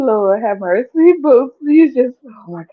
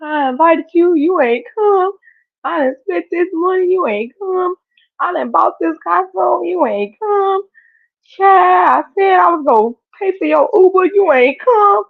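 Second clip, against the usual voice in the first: higher, louder hammed-up scolding of guests who never came; mock complaint; 8 semitones higher, 4 dB louder than usual. Acting out party no-shows.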